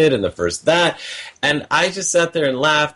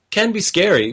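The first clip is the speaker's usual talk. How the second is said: usual speaking voice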